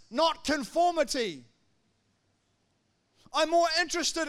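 loud, ringing preacher's proclamation; rising sermon fervour; fervent preaching. Acted. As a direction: preach it out to the congregation. Preaching at full force.

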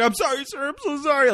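high, pleading apology; terrified kid apologising to a cop; mock scared kid. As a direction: beg forgiveness in a small high voice.

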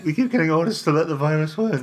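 lightly voiced cracking teenager; a hint of a breaking teen voice; mocking teen impression. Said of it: "not very solid voice".

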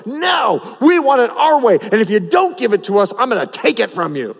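greedy sons threatening the worshippers; threatening shout. Acted.

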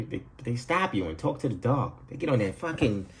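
ordinary delivery

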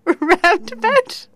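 high, drawn-out Irish mimicry; imitated Irish accent. Trying to get her to say it, imitated Irish accent. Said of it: "It's the most Irish thing I've ever heard".

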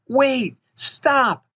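high, urgent cry to halt; contained storyteller's yell, very high; alarmed yell. A narrator's reading. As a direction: call out in alarm, pitch leaping way up. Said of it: "Maria yelled as loud as she could".

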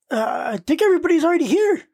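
high, fast put-on voice; mock Goku. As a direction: rattle it off in a whiny cartoon voice.

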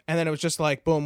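normal talking voice

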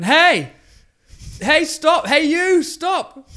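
high, loud imitation of a kid calling out; shouting kid. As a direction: call after a passer-by like an insistent child. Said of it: "Why are you shouting at the man?".

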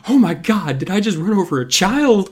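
high-pitched horrified driver; mock horror. Driver who thinks he ran over a child, mock horror.

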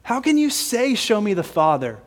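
high, incredulous rebuke; acting out a teacher's disbelief at a student, pitch jumping up; exasperated. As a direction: ask it like there are exclamation points after it. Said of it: "Jesus is maybe a little bit exacerbated".

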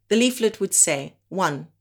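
ordinary talk, nothing marked

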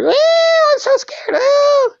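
mock squealing teen girl, very high and loud; teenage girl squeal. Imitating scared teenage girls with a high squealing shout. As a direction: shriek it like a giddy teen at a clown. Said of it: "the teenage girls are".

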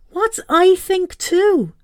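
high and slow, baffled. Acted. Mum baffled by her son's project, high and slow. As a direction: drag it out in disbelief.

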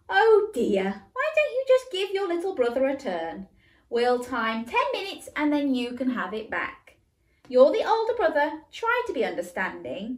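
mum soothing. Acted. Mum voiced in story.

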